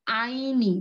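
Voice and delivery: আইনি said slowly as ai-ni with a falling tune; clear drill of the ending. Shown as a demonstration. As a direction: model আইনি carefully, ai then ni. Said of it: "উচ্চারণটা কি পাবো শেষে".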